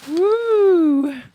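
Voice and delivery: loud high woo; excited cheer. Real, not acted. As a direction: whoop with delight. Champagne being poured.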